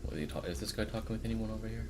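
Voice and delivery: usual speaking voice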